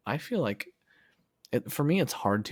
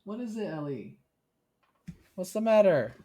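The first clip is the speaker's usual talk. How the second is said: pet talk; 8 semitones higher than usual. Real, not acted. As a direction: coax the little animal over sweetly. Talking to her pet Ellie.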